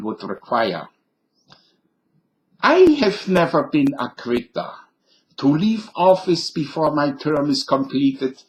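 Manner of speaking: lightly done movie German general; Hollywood German accent. Pretend. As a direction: read as a stiff Hollywood German officer. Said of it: "in the voice of the late General Albert Burkhalter".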